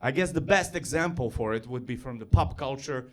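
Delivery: usual speaking voice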